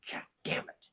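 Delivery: hushed whisper; whispered dismay at an injury; whispered curse. Retold or imitated. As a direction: whisper it under your breath. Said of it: "I took that stop of".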